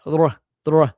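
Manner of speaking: trying and failing to trill "Rr"; failed rolled R. Shown as a demonstration. Streamer shows the best he can do, failed R roll. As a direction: attempt a rolled "Rr" that won't trill. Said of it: "No, I can't".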